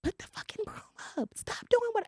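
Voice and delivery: high, strained mock scream, held down in volume. Mother imitating herself screaming at her son, a high mock scream. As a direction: imitate yelling at a kid without full voice. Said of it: "You steady screaming at him".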